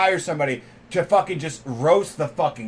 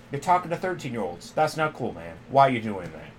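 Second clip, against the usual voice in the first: calm stern Chris Hansen; 6 semitones lower, 7 dB quieter than usual. Retold or imitated. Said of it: "he's just like".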